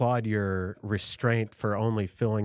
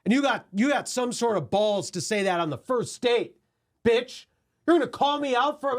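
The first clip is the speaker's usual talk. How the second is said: put-on drunk angry rant; 13 semitones higher than usual. Acting drunk outburst on date.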